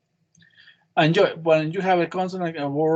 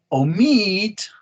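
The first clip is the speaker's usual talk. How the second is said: "Omit" louder and higher, MIT long and strong; stress on second syllable; 6 semitones higher, 5 dB louder than usual. Shown as a demonstration. Teacher points out the second syllable, "Omit" stressed on MIT. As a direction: hit and hold MIT in "Omit". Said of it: "second syllable".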